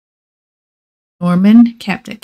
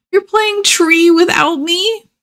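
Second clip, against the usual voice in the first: higher, stunned and wounded question; hurt surprise; 10 semitones higher than usual. Acted. Boy catching his friend playing with another, hurt surprise. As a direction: catch him betrayed mid-game. Norman sees friend with other tree.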